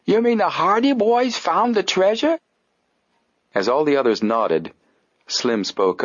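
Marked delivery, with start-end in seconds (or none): 0.0-2.4 high, incredulous detective voice; disbelieving detective. Acted. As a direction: sputter it in disbelief.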